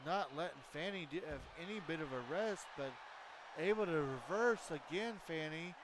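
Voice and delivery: excited wrestling commentary; live play-by-play. Acted. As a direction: call the match like a ringside announcer.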